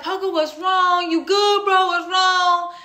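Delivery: high, loud, worried questions; alarmed concern. Retold or imitated. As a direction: imitate a worried friend checking in urgently. Worried friend reacts to her crying.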